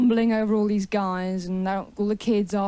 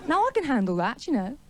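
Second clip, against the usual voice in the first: ordinary talk, nothing marked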